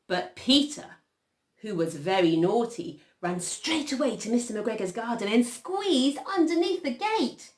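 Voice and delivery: animated storytelling climbing in pitch; story narration. A narrator's reading. Reader telling children of naughty Peter, animated storytelling. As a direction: build up the naughtiness, ending high. Reads a picture story aloud.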